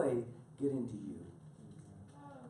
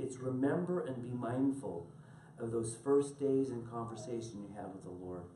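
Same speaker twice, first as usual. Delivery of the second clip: normal talking voice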